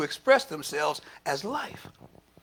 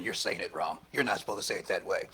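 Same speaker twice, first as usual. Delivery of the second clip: low, softer inner voice of doubt; colonized inner critic; 10 semitones lower, 8 dB quieter than usual. Acted. Preacher voicing his colonized consciousness, low self-correcting voice. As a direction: drop low and quiet, like a nagging thought. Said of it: "colonized consciousness popping up saying".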